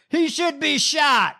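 high, drawn-out mock yell; imitated yelling. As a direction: imitate a heckler yelling from the crowd. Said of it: "someone yelling".

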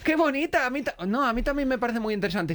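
high-pitched, put-on enthusiasm; feigned admiration. Hiding disgust to save the date, falsely delighted high voice. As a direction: fake being charmed, voice pushed up high. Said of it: "por no estropear la cita, empecé a decir".

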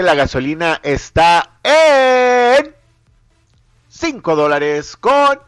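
subraya la cifra más fuerte y despacio; énfasis en el precio. Acted. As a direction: recalca "cinco dólares" alargándolo. Locutor recalca precios. (locutor que marca el precio de la gasolina)

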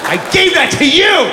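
high, hammed-up victory shouts; triumphant yelling dad. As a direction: crow like the proudest father alive. Father triumphant over ponies.

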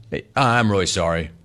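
normal talking voice

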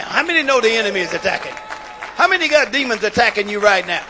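loud, driving sermon delivery; full-voiced pulpit exhortation; forceful preaching. Real, not acted. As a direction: preach it hard to the congregation.

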